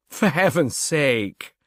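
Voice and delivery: slow, lightly voiced pleased Napier; a hint of a flattered composer, taking his time; visibly pleased. A narrator's reading. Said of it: "visibly pleased".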